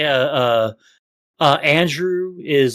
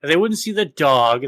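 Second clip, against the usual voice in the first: ordinary delivery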